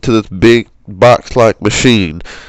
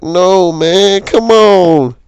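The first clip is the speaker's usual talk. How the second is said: much higher, anguished mock yell; mock scream; 9 semitones higher, much slower than usual. Watching Doctor Trey get sucked in, mock scream. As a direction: cry out as a friend is lost. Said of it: "I'm start screaming and shit".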